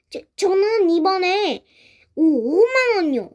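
nervous acted stammer; 긴장한 더듬거림. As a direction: stumble over the first syllables while lying. 거짓말하며 더듬는 대답. (긴장해서 말을 더듬으며)

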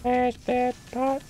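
very high, slow little voice; squeaky play voice. Pretend. Player egging himself on to smash pots, squeaky play voice.

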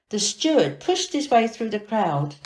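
ordinary delivery

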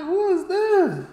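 high, slow, angry shout from the balcony; angry call. Retold or imitated. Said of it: "like, I'm angry".

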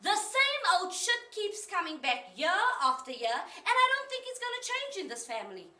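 loud, high-pitched frustration; exasperated outburst. Acted. Woman telling God she already apologized to everyone, exasperated.

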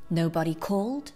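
usual speaking voice

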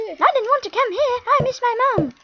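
mock English accent for the game character; put-on English accent. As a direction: read it like a posh English kid. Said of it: "Perfect English accent".

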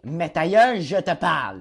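loud, high, exasperated yell; mock angry yell. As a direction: snap it at Olivier like you've had enough. Reading out an angry chat line.

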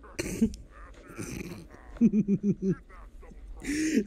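breathless helpless laughter; burst of laughter. Real, not acted. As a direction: crack up uncontrollably. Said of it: "the humor of this game just catches me off guard".

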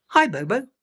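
put-on high, friendly baby elephant voice; the smallest calf saying hello; little Pika Pika.

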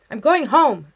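high, loud upset cry; breaking shout. A narrator's reading. Girl shouting through her bedroom door, voice breaking. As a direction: shout it as if close to tears. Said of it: "I shouted, my voice breaking".

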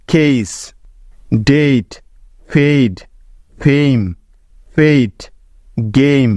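each English word said slowly and clearly, with the vowel of বেইজ as a long "ei"; long a sound demo. Shown as a demonstration. Teacher sounding out words for Bengali students, careful long "ei" vowel. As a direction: sound out every word with a drawn-out "ei" like খেইক. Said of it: "তার আগের এ ভাওয়েলটির sound সবসময় এই".

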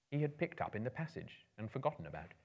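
ordinary delivery

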